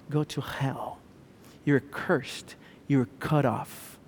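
very soft, low, measured sentence; hushed condemnation. Acted. People cursing the man on the cross, hushed. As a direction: drop the voice and pronounce each curse quietly. Voices the curse on Jesus.

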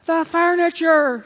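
high, drawn-out loud wail; horrified cry. Acted. Employer sees her dusted furniture, horrified loud cry. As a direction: cry out in hammed-up dismay. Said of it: "she cried".

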